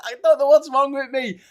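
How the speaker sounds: very high, loud squeak; mock laughing-too-hard-to-talk voice; imitated helpless laughter. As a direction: squeak it out as if overcome by giggles. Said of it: "I can't get the words out 'cause I'm laughing too much".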